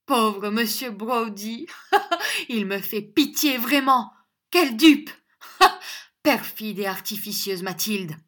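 high, loud mock pity with scornful laughs; stage a grand scornful exit; melodramatic, high-pitched derision; majestic theatrical anger. A narrator's reading. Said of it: "nouvel accès de sombre et majestueuse colère".

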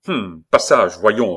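loud, furious demand; boiling anger. Acted. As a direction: demand passage in rising rage. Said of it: "répéta le jeune homme". (colère bouillante et forte)

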